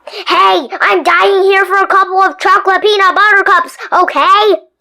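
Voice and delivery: greedy tooth griping; demanding tooth. Acted. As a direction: complain like a spoiled brat. Tooth complaining loudly.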